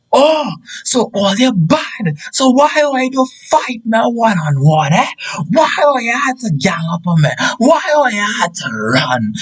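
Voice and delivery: high, strained angry complaint; re-enacted outraged challenge; indignant protest. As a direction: call after them, aggrieved and high-pitched. Acts out shouting after fleeing friends.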